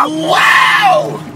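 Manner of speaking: loud breathy cheer; excited squeal. Real, not acted. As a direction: yell it out, thrilled. Mock excitement about dinner.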